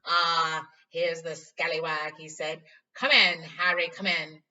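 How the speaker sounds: loud, hearty, showy welcome; beaming Lockhart. Acted. Vain teacher greeting Harry, loud and hearty.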